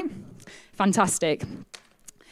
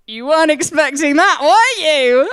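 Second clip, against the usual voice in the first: louder, cheeky teasing question; 6 semitones higher, 9 dB louder than usual. Retold or imitated. Speaker teasing the nervous man on the plane, loudly. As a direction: needle him playfully.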